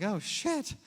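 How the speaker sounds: high, startled "oh, shit"; mock dismay. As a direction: speaker catching his mistake, high and alarmed. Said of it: "I'm like".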